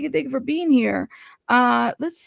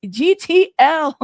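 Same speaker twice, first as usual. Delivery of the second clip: loud, drawn-out catchphrase; Snooki voice; 3 semitones higher, 6 dB louder, slower than usual. Acted. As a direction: call it out slow and loud, reality-show style. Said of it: "Not in my Snooki voice".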